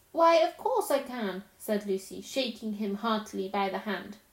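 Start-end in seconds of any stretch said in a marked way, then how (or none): 0.1-1.4 warm. Acted. Lucy forgiving Tumnus.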